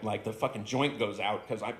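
usual speaking voice

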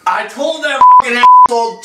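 sudden loud, high angry burst; angry outburst. Acted. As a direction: blurt it out furiously. Sudden outburst about a thief.

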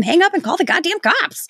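high-pitched exasperated plea at the screen. Real, not acted. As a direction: urge her, fed up, to just call. Exasperated at movie character.

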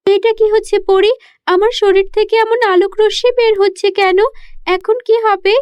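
put-on high child voice, alarmed and puzzled; a frightened girl asking what is happening to her; scared little girl. (ভীত ছোট্ট মেয়ের উঁচু গলা)

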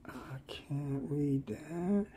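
slow whisper to himself. Real, not acted. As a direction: whisper it slowly, squinting. Squinting at a small label.

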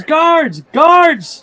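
high, loud cry for the guards; screaming for guards. Acted. Wealthy woman screaming for the guards at a thief. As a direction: shriek it out in alarm. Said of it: "And she screams".

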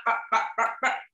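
plain reading voice

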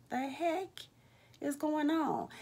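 high, bewildered "the heck is going on". Retold or imitated. Confused quote of herself.

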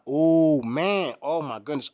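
loud burst on oh, then settling; proud dad's happy outburst; excited exclaim. Retold or imitated. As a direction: blurt out delighted at the shot. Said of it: "I was like".

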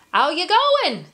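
high, lively kangaroo hello; greeting kangaroo. A narrator's reading. Kangaroo to Emu waiting for a cab, high greeting. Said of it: "the kangaroo greeted Emu".

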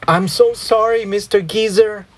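high-pitched, frantic apology; crying out an apology. A narrator's reading. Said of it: "I cried".

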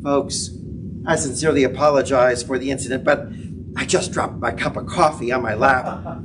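lightly voiced pilot, calm and apologetic. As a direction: play the sheepish captain over the intercom. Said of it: "the pilot comes out".

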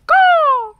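very loud high cheer; cheering goal. A narrator's reading. Rebecca cheers her own goal, very loud and high. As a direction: celebrate the goal. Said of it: "she cheers".

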